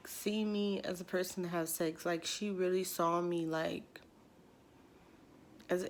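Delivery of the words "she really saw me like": ordinary talk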